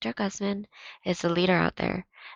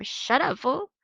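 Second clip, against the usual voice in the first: high angry snap; snapping; 9 semitones higher, 4 dB louder, slower than usual. Acted. Tino snapping at Victor, angry snap. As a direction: snap back at him. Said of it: "Tino snapped at him".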